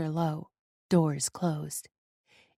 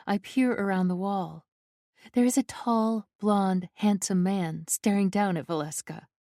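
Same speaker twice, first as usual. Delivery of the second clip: ordinary delivery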